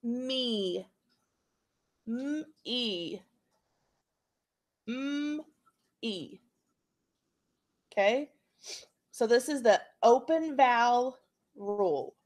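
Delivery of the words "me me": "M-E" as a long hummed mmm, pause, then ee; soft, slow segmenting of "M-E" into mmm and ee; sounding out. Shown as a demonstration. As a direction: stretch each sound of "M-E" separately and softly. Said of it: "So what do we hear?".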